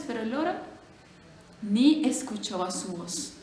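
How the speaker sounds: plain reading voice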